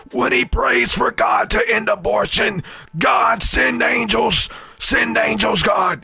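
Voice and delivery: high, shouted fervent preacher prayer; Lou Engle impression. Imitating the preacher Lou Engle praying to end abortion, shouting high. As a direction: wail it like a revival preacher begging God. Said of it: "Lou Engle, if you know church culture".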